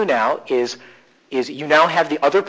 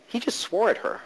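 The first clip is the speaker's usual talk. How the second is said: hushed, higher-pitched disbelief; softly taken aback, pitched up; astonished aside; 7 semitones higher, 6 dB quieter than usual. Retold or imitated. Mentor's companion reacting to the swearing, hushed astonishment.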